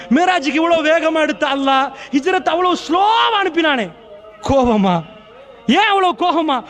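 high-pitched, ringing sermon cry; full-force preaching. Real, not acted. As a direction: fire off rhetorical questions at a high, urgent pitch. Preaches at full force, rhetorical questions.

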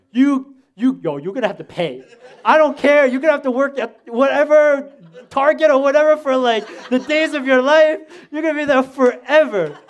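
loud, high, comic retold tirade; pissed-off rant. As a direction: reenact threatening your friend at full volume. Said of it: "I was so pissed off".

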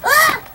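a sudden high yelp, loud; pretend pained yelp. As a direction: yelp as the sting hits. Bee stung, pretend play.

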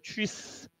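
"tschüss" with a clear, sustained final s; clearly pronounced. Shown as a demonstration. Teacher showing students a clear German goodbye, distinct "tschüss". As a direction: articulate "tschüss" distinctly, right through the ending. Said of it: "要很清楚，tschüss".

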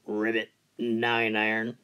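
low, soft croaking frog. Acted. Golfer hears a frog on the green, low soft frog voice. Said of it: "So I look around".

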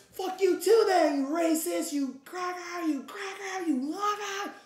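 high-pitched mock outrage; mock angry retort. [name] cursing back at strangers, high mock-angry voice. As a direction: fire back insults in a squeaky put-on voice.

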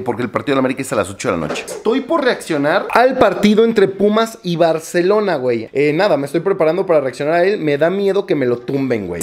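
ordinary talk

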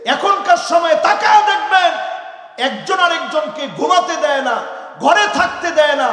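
loud, high-pitched rebuke from the pulpit; heated sermon shout; impassioned preaching. Real, not acted. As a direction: preach it at full force, driving each phrase. Preaching at full force.

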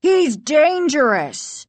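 high, slow urgent warning; exclaiming. A narrator's reading. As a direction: exclaim it high, stretching the words. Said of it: "the other Doctor Brewer exclaimed".

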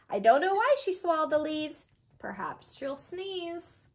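ordinary delivery

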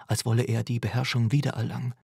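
plain reading voice

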